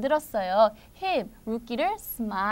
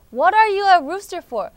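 higher, brisker take of "What are you a rooster for?"; quicker challenge; 4 semitones higher, 7 dB louder, much slower than usual. Acted. Friend repeats the challenge to the rooster, brisker and higher. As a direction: say it in one run, loud and falling at the end.

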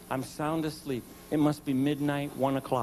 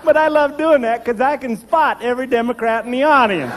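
high, loud, words through laughter; laughing; 11 semitones higher, 8 dB louder than usual. Real, not acted. Comic telling the audience his joke, laughing hard.

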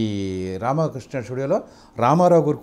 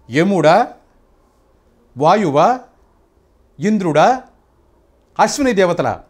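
NTR Duryodhana dialogue. Shown as a demonstration. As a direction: proclaim it as the proud emperor before the court. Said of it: "Ramarao గారిది దానవీర స్వర్గంలో dialogue చెప్తాను".

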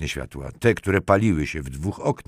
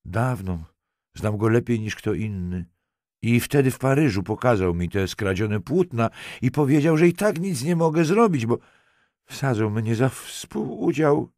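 tearful confession; 6 semitones higher than usual. A narrator's reading. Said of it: "przeszedł w gwałtowny płacz".

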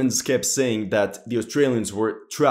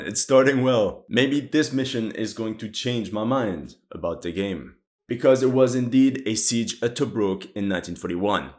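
ordinary talk

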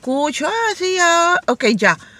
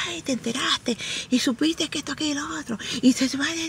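hushed, conspiratorial gossip; gossip whisper; 4 semitones higher, 10 dB quieter than usual. Retold or imitated. Coworker dishing gossip quietly at work. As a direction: lean in and dish the gossip softly.

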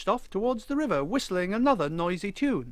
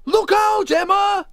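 very high, very loud warning shout; bellowing; 11 dB louder, slower than usual. A narrator's reading. Wally warns Emma at the river, bellowing. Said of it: "he bellowed".

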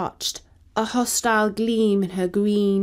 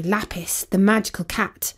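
ordinary delivery

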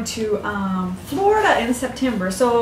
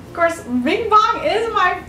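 ordinary talk

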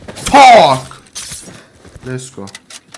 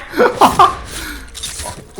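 high laugh; 10 semitones higher than usual. Real, not acted. Laughs at teammate's miss.

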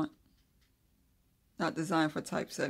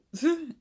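short laugh; 11 semitones higher, 6 dB louder than usual. Real, not acted. Laughs at her own difficulty.

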